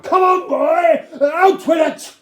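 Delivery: high, loud, very slow roar; parade-ground shout. A narrator's reading. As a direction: command a platoon of soldiers. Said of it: "shouting at me as I was a platoon".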